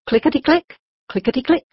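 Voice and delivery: bright, sing-song clickety-click; tapping sound effect. A narrator's reading. Narrator mimicking hooves tapping on the dock, sing-song.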